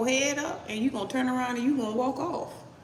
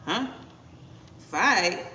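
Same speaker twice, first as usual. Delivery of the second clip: ordinary talk, nothing marked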